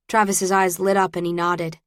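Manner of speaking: plain reading voice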